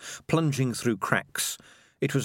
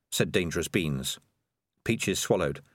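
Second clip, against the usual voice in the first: plain reading voice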